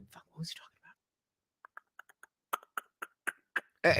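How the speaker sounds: barely audible whisper; muttered to self. Real, not acted. Speaker loses the thread of Trump's speech, whispering to self. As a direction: whisper it to yourself while trying to remember.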